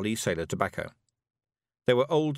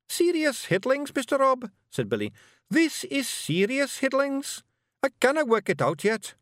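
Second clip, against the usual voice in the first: put-on high boyish Scots, thinking hard; young Scottish pictsie bard. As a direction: ponder it like a nervous young bard. Young Gonigal Billy's voice.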